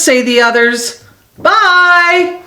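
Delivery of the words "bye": high, sing-out storybook farewell; mildly called out; called goodbye. A narrator's reading. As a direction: call out a high, drawn goodbye. Friends call out a goodbye.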